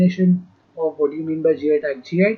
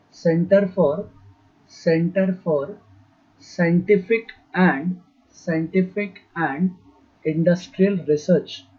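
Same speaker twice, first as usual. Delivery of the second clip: measured phrases, drawn out for copying; slow dictation; slower than usual. Real, not acted. Teacher giving students a name to write, slow dictation. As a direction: say each chunk slowly, pausing between. Dictating full form for writing down.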